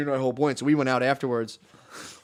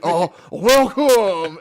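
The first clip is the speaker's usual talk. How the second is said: slow, drawn-out host greeting, higher and louder; mock formal welcome; 5 semitones higher, 8 dB louder, much slower than usual. Host greeting guests at the imagined club, slow and hammed-up. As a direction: stretch the welcome out like a solemn host. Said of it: "a whole thing where it's like".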